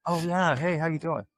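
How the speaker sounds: light imitation of a jovial governor; hearty greeting. Voicing Rick Perry greeting.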